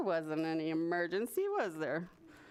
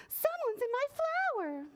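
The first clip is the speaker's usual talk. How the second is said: high-pitched fretful storybook voice; high, worried bug voice; flustered ladybug. A narrator's reading. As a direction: fret like a panicky little ladybug. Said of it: "she flapped".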